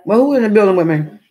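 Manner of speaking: loud call to the room. Real, not acted. Streamer calls out to viewers, loud and hyped. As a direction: call out to everyone listening.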